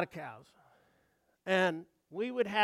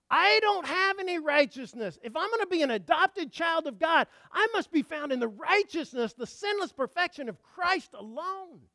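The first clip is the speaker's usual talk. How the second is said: raised, driving pulpit voice; full-force preaching; 7 semitones higher, 6 dB louder than usual. Acted. Preacher declaring Paul's words to the congregation, full-force preaching. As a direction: preach it high and urgent.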